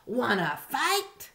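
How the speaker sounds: high, slow squeaky dare; grouchy ladybug. A narrator's reading. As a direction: challenge them in a tiny high voice. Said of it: "said the grouchy ladybug".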